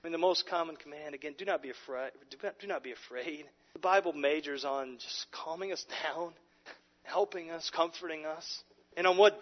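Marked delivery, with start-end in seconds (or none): none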